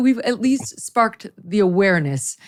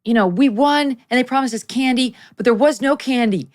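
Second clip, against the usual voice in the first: little son complaining; 7 semitones higher than usual. Retold or imitated. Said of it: "And he said".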